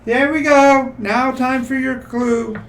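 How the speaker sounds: high, loud troll voice. Acted. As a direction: play the troll gloating. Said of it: "he goes".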